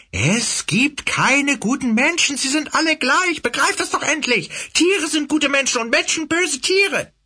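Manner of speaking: high, loud, hate-filled tirade; hateful rant. Acted. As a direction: spit it out in impotent fury. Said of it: "ohnmächtigem Zorn und Hass".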